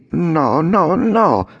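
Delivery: high puppet voice saying no; Pinocchio refusing. Acted. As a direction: shake off the temptation. (Pinocchio che rifiuta, voce alta)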